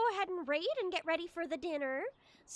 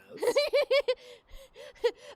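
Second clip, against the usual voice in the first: laughing; 11 semitones higher, 7 dB louder than usual. Real, not acted.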